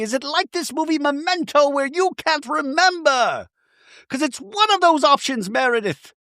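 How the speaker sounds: high-pitched mock interrogation; acted angry tirade. Accuser demands whether Meredith lies, hammed-up anger. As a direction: fire accusing questions high and hard.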